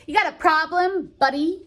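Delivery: high, loud sarcastic taunts; mock tough-guy challenge. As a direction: fake politeness while picking a fight. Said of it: "It's kind of like how if you're like".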